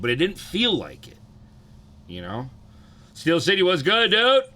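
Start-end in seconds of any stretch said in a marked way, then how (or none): 3.1-4.4 high, loud shout-out, rising at the end; excited streamer hello, pitched way up; hollered greeting. Real, not acted. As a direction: call out to a friend who just showed up. Calls out greeting to arriving viewer.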